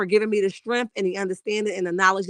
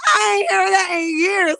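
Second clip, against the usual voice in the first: delighted shriek; 7 semitones higher than usual. Real, not acted. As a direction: squeal it out in glee. Delighted reaction to a joke.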